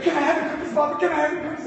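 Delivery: put-on child begging. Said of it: "I got".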